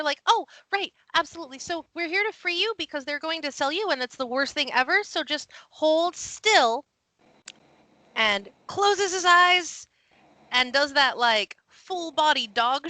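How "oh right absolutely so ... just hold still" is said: high, rushing, excitable little pup; very excited. Acted. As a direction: babble eagerly as the excitable little rescuer. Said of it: "very excitedly over here".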